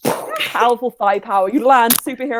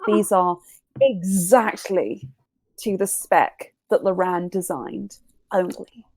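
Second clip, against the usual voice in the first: story narration; 2 semitones lower, 5 dB quieter, slower than usual. A narrator's reading. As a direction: tell it like a dramatic reveal. Dramatic scene narration.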